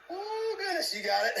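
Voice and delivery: strained high laugh mid-rep; laughing through the burn; laughing through pain. Real, not acted. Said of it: "I'm laughing through it".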